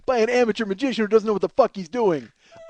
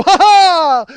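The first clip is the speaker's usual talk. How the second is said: huge, high burst of laughter; loud mimicked cackle from the back; re-enacted hysterical laugh; 9 semitones higher, 13 dB louder than usual. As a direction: belt out a jackass laugh. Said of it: "you hear me just go".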